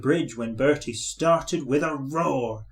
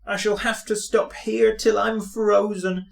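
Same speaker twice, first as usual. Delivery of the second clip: sad; 7 semitones higher than usual. A narrator's reading. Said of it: "said Thomas sadly".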